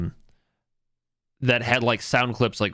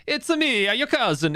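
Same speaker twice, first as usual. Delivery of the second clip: mock Italian accent, pitched much higher; shitty Italian accent; 12 semitones higher than usual. Podcast player voicing a goblin in mock Italian accent. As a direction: ham up a bad Italian accent, Mario style. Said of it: "like, shitty Italian".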